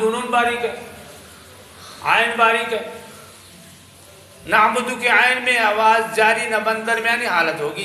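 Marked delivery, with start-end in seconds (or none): none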